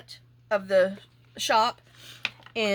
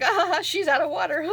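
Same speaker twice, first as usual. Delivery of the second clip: voicing the rooster; 9 semitones higher than usual. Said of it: "now he's like".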